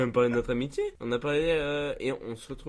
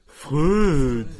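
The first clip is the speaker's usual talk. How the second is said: loud "Freud!" with a hugely stretched vowel; very long Freud; 2 semitones higher, 9 dB louder than usual. Retold or imitated. Retelling Marc's funny "Freud!", loud and very long. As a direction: drag "Freud!" out at full volume. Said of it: "Marc a fait un Freud très long".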